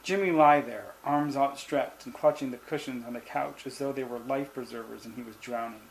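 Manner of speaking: usual speaking voice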